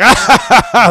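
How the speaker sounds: loud, high mock guffaw; big hammed-up laugh burst; imitated laughing. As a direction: imitate someone cracking up. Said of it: "and he's laughing".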